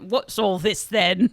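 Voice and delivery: mock Cockney copper, laughing; chirpy Cockney with a laugh; Cockney accent. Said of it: "a Cockney accent".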